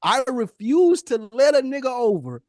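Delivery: ordinary delivery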